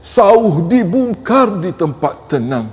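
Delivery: slow, firm verse delivery; measured, declaimed wise words; firm recitation. Shown as a demonstration. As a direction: recite the pantun slowly and emphatically. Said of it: "Kadang-kadang cukup tegas".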